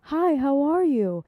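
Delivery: high, drawn-out friendly greeting; polite greeting. Retold or imitated. Grandma showing shop lady how to greet, polite and high. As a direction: model a sweetly polite hello, slowly. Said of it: "you greet them politely".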